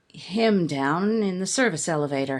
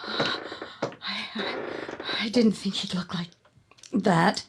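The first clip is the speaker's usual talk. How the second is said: shaken whisper; 3 dB quieter than usual. Acted. Shocked after the killing.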